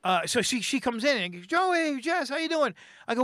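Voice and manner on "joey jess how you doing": high, bright retold hello; imitating an excited friend; excited greeting. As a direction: greet him like an old friend, pitched way up. Jess greets him excitedly.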